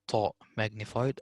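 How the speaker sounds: ordinary talk, nothing marked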